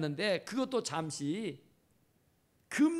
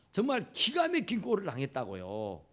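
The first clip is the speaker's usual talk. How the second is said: normal talking voice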